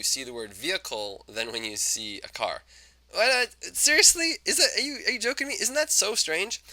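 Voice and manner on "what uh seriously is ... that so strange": mock astonishment. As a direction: act stunned by the finding. Lecturer feigns astonishment at finding.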